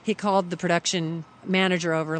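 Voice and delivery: ordinary talk, nothing marked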